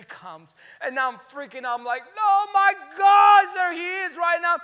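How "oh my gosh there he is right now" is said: high, very loud excited yell; yelling full force. Retold or imitated. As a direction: yell it like a starstruck fan. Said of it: "yelling at the top of my lungs".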